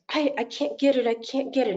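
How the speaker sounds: put-on high, whiny little-boy voice; frustrated little boy. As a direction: mimic a five-year-old giving up. Grandson voiced in story.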